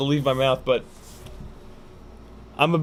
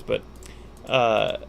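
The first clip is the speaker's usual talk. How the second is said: ordinary delivery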